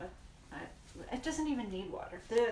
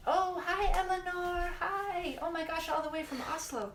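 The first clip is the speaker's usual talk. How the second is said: high-pitched, excited hello; excited, voice lifted high; delighted greeting; 9 semitones higher than usual. Real, not acted. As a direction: greet a faraway friend with happy surprise. Greeting a viewer from far away.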